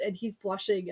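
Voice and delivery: ordinary delivery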